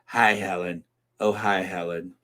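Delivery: Louise Jefferson. Acted. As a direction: drop the voice for a mock greeting. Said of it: "as Louise Jefferson would say".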